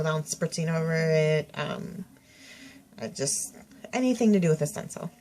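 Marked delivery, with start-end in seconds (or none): none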